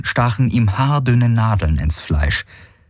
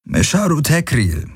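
casual; 9 semitones higher than usual. A narrator's reading. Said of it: "gab er locker zurück".

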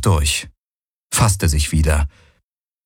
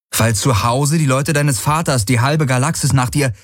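put-on higher young woman's voice, hurt and reproachful; Kirai pleading; 6 semitones higher than usual. Kirai confronting Galen about his obsession, hurt and pleading. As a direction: plead with him, rushing the words.

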